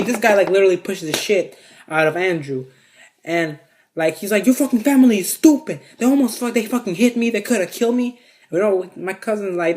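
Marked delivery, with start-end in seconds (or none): 4.5-8.2 high-pitched mock rant; imitated outraged accusation; angry ranting. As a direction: complain furiously as the offended man. Man ranting at Andrew, acted out.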